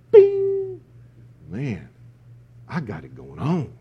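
hammed-up smug revelation; mock instant enlightenment. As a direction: play the lazy believer who thinks he got wisdom overnight. Smug believer waking up enlightened.

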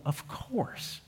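breathy whisper, almost to himself; whispered aside. Real, not acted. Pastor whispering to the congregation, a quiet aside. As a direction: drop to a whisper for the obvious answer.

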